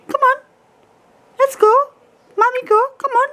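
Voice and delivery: squeaky call to a pet bird; high coaxing pet voice. Real, not acted. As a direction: coax the bird up high and sweet. Coaxing parrot to bed.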